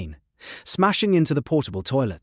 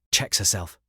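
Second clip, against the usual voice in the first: ordinary delivery, nothing marked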